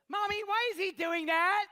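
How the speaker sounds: put-on high, loud child's voice; loud child asking mom; little girl. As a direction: imitate a curious little girl. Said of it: "she, she goes".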